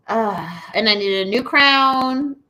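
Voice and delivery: ordinary delivery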